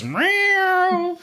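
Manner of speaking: high complaining meow; complaining cat. Retold or imitated. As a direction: whine like a cat. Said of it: "And the cat goes".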